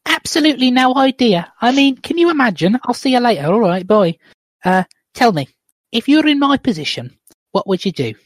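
high-pitched voice for a film wife; female character voice. Acted. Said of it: "do two different voices for this scene".

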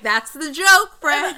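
high and loud through laughter; laughing mock reply. Podcaster laughing at the Russian tourist's joke, loud. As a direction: crack up while delivering the punchline.

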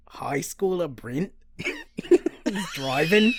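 drawn-out mock Australian accent; hammed-up Australian accent, breaking into laughter. Host jokingly reading the plot to co-host, in an Australian accent. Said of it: "read it in an Australian accent".

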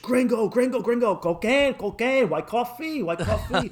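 high, repetitive hawker's pitch; pushy street hawkers. Retold or imitated. Colombian dealers pester two gringos, high pushy calls. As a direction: imitate street dealers badgering a tourist.

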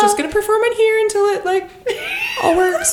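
squeaky, mock-tearful seventeen-year-old; odd high voice. Pretending to go live for waiting fans, squeaky high voice. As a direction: put on a very high, whimpering teen voice. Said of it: "Why is your voice like that?".

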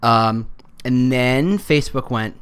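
usual speaking voice

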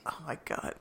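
imitated exasperated whisper; whispered exasperation. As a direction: whisper it under your breath, mock-exasperated.

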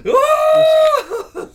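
sudden high scared scream; high shriek. Pretend. Startled by the spider again, high shriek. Spider still there on waking.

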